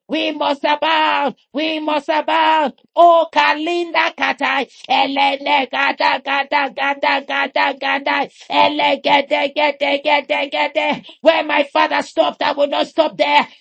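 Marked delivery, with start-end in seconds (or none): none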